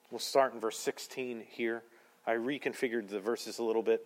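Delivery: ordinary delivery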